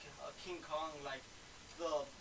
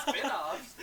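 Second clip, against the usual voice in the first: laughing; 3 semitones higher, 8 dB louder than usual. Real, not acted. Laughing disbelief.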